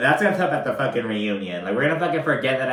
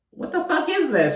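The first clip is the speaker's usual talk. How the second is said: mock outrage. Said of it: "She's like".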